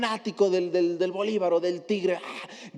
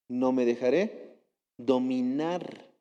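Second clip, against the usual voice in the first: normal talking voice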